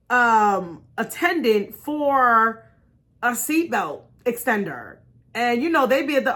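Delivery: ordinary talk, nothing marked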